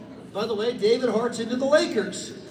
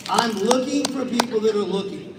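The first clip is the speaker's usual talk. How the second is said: normal talking voice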